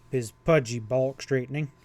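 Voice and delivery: plain reading voice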